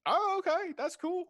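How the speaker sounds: very high, loud little gnome; bright squeak of surprise; squeaky gnome voice. Acted. Gnome character reacts.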